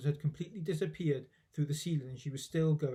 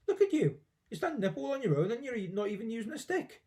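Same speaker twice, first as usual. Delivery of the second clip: excited; 7 semitones higher than usual. A narrator's reading. George amazed at Grandma.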